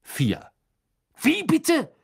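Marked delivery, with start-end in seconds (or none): 1.2-1.9 outraged bellow, high and loud; angry roar. Acted. Ron yells at Karkaroff over the unfair score, furious roar. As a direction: explode in furious disbelief. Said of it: "brüllte Ron zornig".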